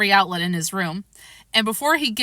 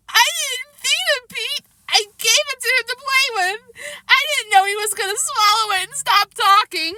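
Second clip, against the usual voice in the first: tearful plea; 3 dB louder than usual. A narrator's reading. Child pleading in tears.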